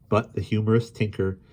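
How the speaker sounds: plain reading voice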